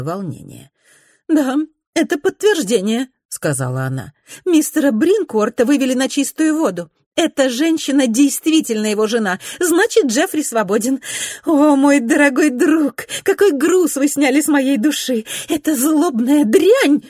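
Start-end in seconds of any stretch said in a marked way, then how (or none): 1.3-3.1 emotional cry. A narrator's reading. Mrs Glenarm overwhelmed with emotion. 4.5-17.0 high, excited gossiping lady; a high-pitched, eager young society lady. A narrator's reading. Narrator voices Mrs Glenarm.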